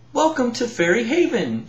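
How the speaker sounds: a lightly voiced Tink greeting the new fairy; Tink's greeting.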